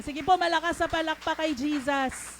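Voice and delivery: loud, rousing call for applause; rally the crowd at full voice. Real, not acted. Preacher urging congregation to clap for Jesus, loud rousing call.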